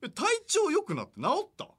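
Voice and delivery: high, incredulous; mock surprised question. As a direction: needle him with mock concern. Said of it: "と思って". (皮肉っぽく驚く)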